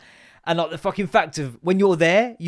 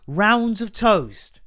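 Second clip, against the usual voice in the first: slow, higher repeat of the phrase; incredulous scorn; 5 semitones higher, 3 dB louder, much slower than usual. Acted. Ranting about an unacceptable phrase, slow mock disbelief. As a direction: stretch each word out in disbelief.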